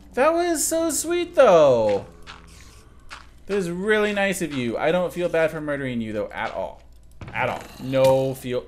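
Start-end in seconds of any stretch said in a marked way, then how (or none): none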